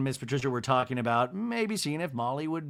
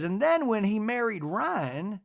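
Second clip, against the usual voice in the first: high, slow society matron; Miss Patricia; 7 semitones higher, slower than usual. Retold or imitated. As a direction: imitate a grand hostess, drawn out and lilting. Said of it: "And she says".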